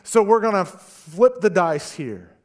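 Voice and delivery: high, loud panicked sailors' voice; frantic sailors. Acted. Sailors in the storm deciding to cast lots, loud and high. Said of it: "And the sailors go".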